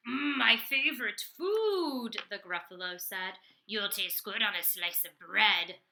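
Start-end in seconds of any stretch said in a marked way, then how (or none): 0.1-2.2 loud, slow, relishing growl of delight; big drawn-out monster voice; hungry monster. Acted. Gruffalo greets mouse as food. 3.7-5.8 hungry monster. Acted. Gruffalo threatens to eat mouse.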